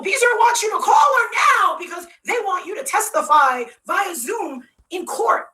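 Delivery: high mock shouting; frantic demand. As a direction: imitate someone urgently hollering orders. Imitating Jill's frantic demand.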